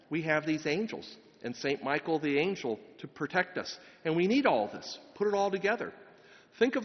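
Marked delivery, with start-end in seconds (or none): none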